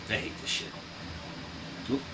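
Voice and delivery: usual speaking voice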